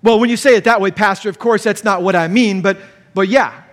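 a hint of a flustered church member; caught-out spouse mildly hedging to the pastor; defensive congregant. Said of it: "resonate what they've said back".

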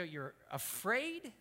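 slow, drawn-out disbelieving question; mildly incredulous. Retold or imitated. Pastor questions a young man's fear, mildly incredulous. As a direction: stretch it out in mild disbelief.

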